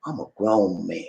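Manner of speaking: hushed, grave assertion of manhood; dead serious. Shown as a demonstration. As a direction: lay down the law softly. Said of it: "it is serious".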